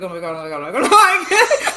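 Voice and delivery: comic mounting dread through clenched teeth. Retold or imitated. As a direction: start tight-jawed, then let the nerves burst out. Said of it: "a whole conversation through the teeth".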